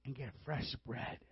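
very soft and low, with long pauses; hushed prophetic word. Retold or imitated. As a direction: re-enact a solemn message, almost under the breath. Re-enacts woman's solemn prophetic word.

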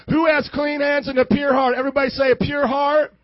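booming scripture reading; preaching. Acted. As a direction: preach it loud to the church.

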